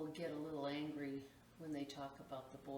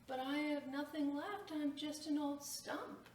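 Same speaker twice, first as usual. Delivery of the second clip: put-on pleading old tree; 8 semitones higher than usual. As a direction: beg forgiveness as a worn-out stump. Tree apologises with nothing left.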